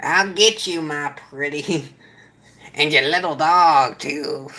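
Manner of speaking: a weak, barely voiced Wicked Witch line; half-hearted try at the witch; bad witch impression. Streamer attempts the witch line after mentioning witch fingers, voice barely changed. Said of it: "That was a horrible impression".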